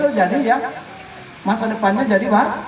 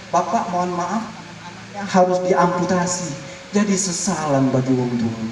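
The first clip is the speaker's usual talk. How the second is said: somber doctor; 8 semitones lower, 3 dB quieter than usual. Retold or imitated. Doctor giving bad news.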